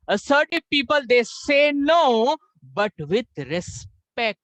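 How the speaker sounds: very slow, high, drawn-out stress; slow lecturing emphasis. Shown as a demonstration. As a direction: spell it out word by word. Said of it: "teacher stressing key point".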